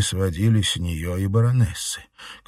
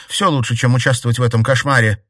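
defiant; 4 semitones higher, 6 dB louder, faster than usual. A narrator's reading. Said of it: "с вызовом бросила Катерина".

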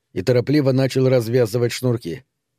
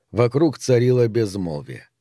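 plain reading voice

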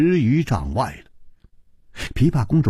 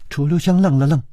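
usual speaking voice

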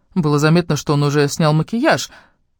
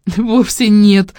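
giggling; 6 semitones higher, 5 dB louder, much slower than usual. Acted. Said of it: "прыснула со смеху Катя".

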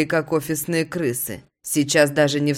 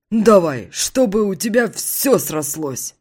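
raised, slow toasting buddy; 5 semitones higher, 3 dB louder than usual. Acted. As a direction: raise the glass. Friend toasting.

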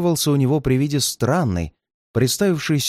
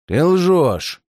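roaring redhead; 4 semitones higher, 3 dB louder than usual. A narrator's reading. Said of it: "заревел он".

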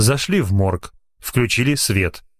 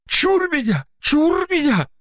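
put-on much higher, terrified old man's wail; piercing terrified cry; slower than usual. A narrator's reading. Old watchman fleeing the moving corpse in terror. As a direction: ward off the devil in panic. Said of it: "пронзительно закричал он".

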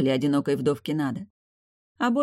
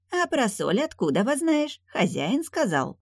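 suspicious; 9 semitones higher than usual. Acted. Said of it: "с подозрением поинтересовался".

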